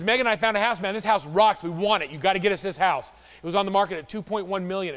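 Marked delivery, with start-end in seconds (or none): 0.0-3.1 loud, higher eager buyer; loud thrilled husband; excited client. Retold or imitated. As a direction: gush about the house. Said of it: "He says".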